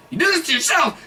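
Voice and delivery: high mock yell with a sob; yelling it tearfully at his friend; retold yell. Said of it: "He's, like, yelling at him".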